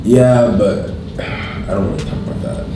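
ordinary talk

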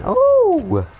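high, loud startled oh; spotted by a guard, a high loud startled oh. Real, not acted. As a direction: jump at a sudden fright.

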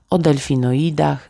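plain reading voice

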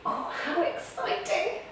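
breathless hushed excitement; excited whisper. Pretend. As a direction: whisper it like a thrilled secret. Pretend space trip, excited whisper.

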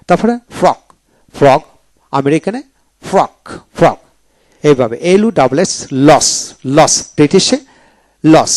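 normal talking voice

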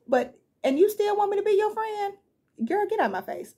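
high, sassy brush-off; sassy dismissal. Pretend. Talking back to a clingy friend, sassy and high. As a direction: shoo her away with attitude, up high.

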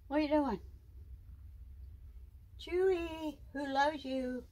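high, cooing voice to her pet. Real, not acted. Owner cooing at her pet snake in high baby talk.

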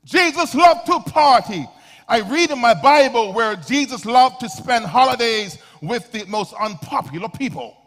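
high, loud preaching shout; defiant proclamation. Real, not acted. Preacher insisting to critics that Jesus loved company, defiant proclamation. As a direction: hammer each word out at full voice.

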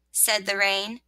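ordinary delivery, nothing marked

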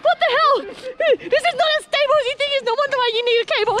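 fast, high, frightened chatter on the shaky bridge; alarmed excitement. Real, not acted. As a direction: blurt it out in a panic. Wobbly bridge walk.

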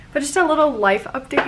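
ordinary delivery

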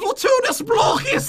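hammed-up English accent, very high and drawn out. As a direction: belt "Fortuna's Blockus" like a mock Brit wizard. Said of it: "get my English accent on".

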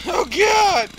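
high, loud mock yelp; echoing the sound bite. Gamer repeating the game's scream, high loud mock yelp. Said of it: "I love the little sound bites".

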